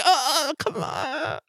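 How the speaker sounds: high, slow, whiny squawk; squawking protest. Acted. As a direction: squawk like someone reaching for a snatched thing. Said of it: "there's this squawking".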